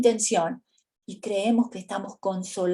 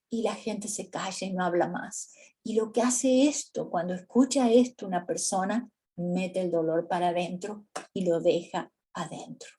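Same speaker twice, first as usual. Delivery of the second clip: ordinary talk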